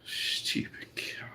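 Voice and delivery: whispered under the breath, slow; whispered insult. Real, not acted. Insulting the woman at the till, whispered. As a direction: hiss it so she can't hear.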